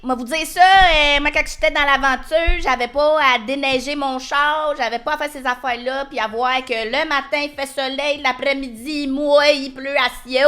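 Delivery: put-on high, loud baby voice; cutesy baby talk. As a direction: babble it like a whiny toddler. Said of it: "Parle en bébé pendant trente secondes".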